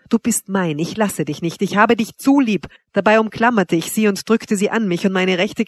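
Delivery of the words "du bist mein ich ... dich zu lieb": ordinary delivery, nothing marked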